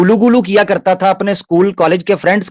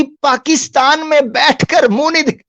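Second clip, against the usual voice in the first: mocking; 7 semitones higher than usual. Mockingly quotes opponent's claim.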